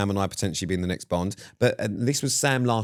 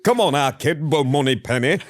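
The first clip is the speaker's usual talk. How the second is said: louder, higher mock Yorkshire Bond; 6 semitones higher, 4 dB louder than usual. Yorkshire accent, mocking a Bond line to Moneypenny. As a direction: bellow it like a Yorkshire lad playing Bond. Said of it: "Moneypenny from Yorkshire".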